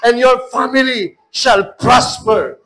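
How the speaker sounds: from steady to loud, high shouting; building preacher's declaration. Acted.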